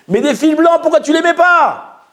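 high, exasperated reproach; indignant questioning. Acted. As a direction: demand an explanation, pitched right up. (question indignée)

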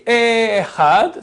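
"Echad" with the opening E stretched; slow "Echad", long E split from chad; wrongly long E. Shown as a demonstration. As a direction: drag the first vowel of "Echad". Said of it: "faire attention que le E soit pas long".

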